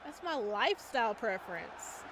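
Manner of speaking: usual speaking voice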